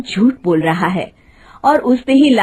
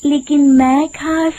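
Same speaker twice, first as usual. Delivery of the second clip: higher, honeyed woman's voice, luring him in; sweet voice; 6 semitones higher than usual. Acted. Disguised witch sweet-talking Hariya, high honeyed voice. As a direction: sweet-talk the cart driver like a lonely lady. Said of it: "मीठी आवाज में कहती है".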